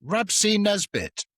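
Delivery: slow, high and loud blurt; shop girl naming a star. Retold or imitated. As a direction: announce the name with glee. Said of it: "she went".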